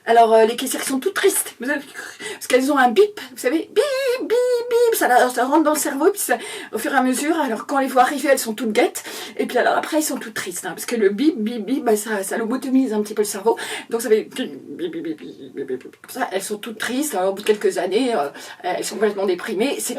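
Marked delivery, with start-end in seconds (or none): 3.7-4.9 high, drawn-out electronic beeps; piercingly high held "bip"; imitated scanner beep. 14.2-16.0 low, soft string of beeps; imitated beeping. Imitates beeps droning in the brain.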